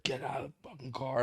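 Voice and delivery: imitating the other driver's hostile order; angry driver. Road-rage driver ordering him out, imitated mock anger.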